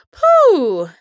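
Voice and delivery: high, loud exhale of relief; relieved loud phew. Acted. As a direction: blow out a big relieved puh. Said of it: "rief Applejack". (lautes, erleichtertes Puh)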